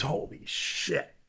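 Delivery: slow, hushed, breathy shock; shocked whisper. Retold or imitated. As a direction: whisper it like you can't believe it.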